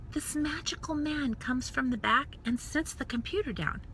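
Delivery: whispered storytelling awe; hushed wonder. Real, not acted. Vlogger describing the computer arriving, in a hushed whisper. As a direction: whisper it like magic.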